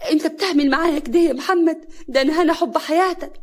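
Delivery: high, loud, wounded appeal; hurt protest. Acted. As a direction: plead with him in disbelief. Wife protests being dragged away.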